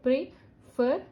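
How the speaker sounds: pre, then fer, with a gap; split into syllables. Shown as a demonstration. Teacher counting syllables for learners, split into syllables. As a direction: break "prefer" into two separate syllables. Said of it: "Prefer is two syllable word".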